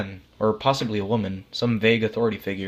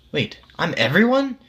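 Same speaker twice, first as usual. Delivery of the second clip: higher and louder, a sudden stunned question; stunned disbelief; 9 semitones higher, 5 dB louder than usual. A narrator's reading. The dead man grasps he is everyone, stunned. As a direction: let the realization jump out of you.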